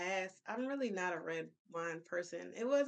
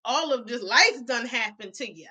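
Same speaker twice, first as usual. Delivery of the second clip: loud, emphatic declaration; loud rant; 3 semitones higher, 12 dB louder than usual. Real, not acted. Friend loudly telling the guests their troubles are in their head. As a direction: hammer it home to the room.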